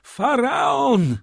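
very high, drawn-out loud shout; exclaiming. A narrator's reading. Young man announces the lost ship, excited high shout. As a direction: shout the ship's name in elation. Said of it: "wykrzyknął".

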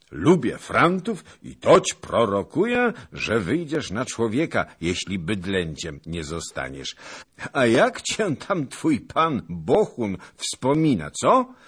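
loud, high, teasing oratory; hearty booming banter; boisterous old noble. A narrator's reading. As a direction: proclaim it with gusto, then prod for an answer.

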